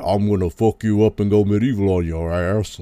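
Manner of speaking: lightly voiced movie tough talk. Mocking Tarantino's film characters, lightly caricatured tough-guy voice.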